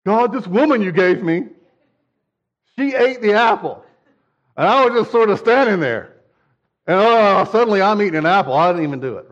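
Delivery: high, loud, whining excuse; whiny Adam excuse. Retold or imitated. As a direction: plead innocence to God, a bit whiny. Adam's excuse voiced to God.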